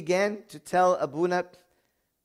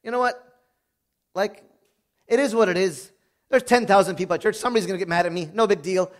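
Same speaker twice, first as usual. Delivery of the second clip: dismissive shrug; 4 semitones higher, 6 dB louder than usual. Retold or imitated. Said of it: "I could have said".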